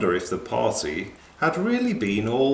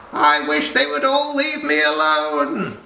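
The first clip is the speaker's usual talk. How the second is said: despairing cry; 6 dB louder than usual. Acted. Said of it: "she cried".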